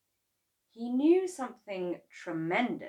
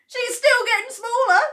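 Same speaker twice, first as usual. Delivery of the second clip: gleeful shout; 13 dB louder than usual. Acted. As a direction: crow with delight as grandma shrinks. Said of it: "shouted Mister Cranky gleefully".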